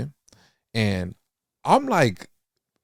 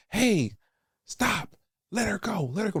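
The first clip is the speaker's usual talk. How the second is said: retold parents' yell; 12 semitones higher than usual. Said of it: "these parents next to me screaming".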